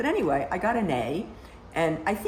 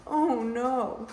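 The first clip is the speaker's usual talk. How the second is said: higher-pitched "oh no" of dismay; retold dismay; 6 semitones higher than usual. Woman retelling her reaction to a letter about George's death, mock dismay. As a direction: act out your dismayed reaction.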